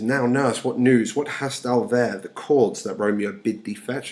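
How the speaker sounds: Shakespeare read aloud with projection; dramatic play reading. Acted. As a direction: declaim Juliet questioning her nurse. Reads Juliet's lines aloud.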